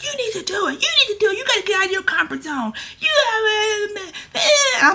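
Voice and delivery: high, pushy mock voice for her husband; imitating Micah. Imitating Micah urging her to take the gig, high and squeaky. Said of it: "He was like".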